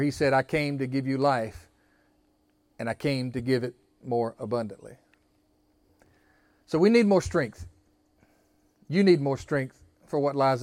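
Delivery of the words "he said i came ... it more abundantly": hushed quoting. Acted. Preacher softens quoting Jesus.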